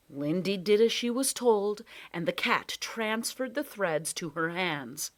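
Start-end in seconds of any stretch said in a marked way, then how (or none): none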